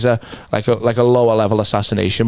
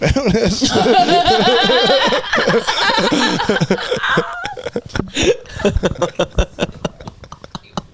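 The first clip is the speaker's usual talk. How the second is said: laughing; 12 semitones higher than usual. Real, not acted. Long laugh at own joke.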